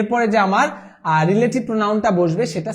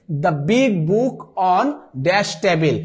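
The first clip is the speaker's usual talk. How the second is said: slow dictation read; much slower than usual. Shown as a demonstration. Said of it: "reading the filled sentence".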